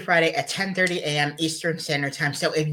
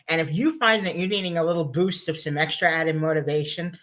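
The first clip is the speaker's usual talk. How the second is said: ordinary delivery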